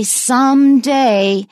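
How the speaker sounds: slow, forceful "someday"; emphatic someday. Shown as a demonstration. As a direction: punch both halves of "someday". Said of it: "very strong and emphatic".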